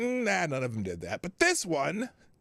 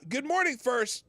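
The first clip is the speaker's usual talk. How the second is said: bright loud greeting; 10 semitones higher, 4 dB louder than usual. Real, not acted. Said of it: "greeting the chat".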